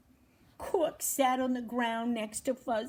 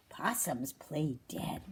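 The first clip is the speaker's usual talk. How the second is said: unvoiced, lower whisper; 7 semitones lower, 7 dB quieter than usual. Fuzz tells Cork why he lay still, whispered. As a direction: share it like a secret. Fuzz explains playing dead.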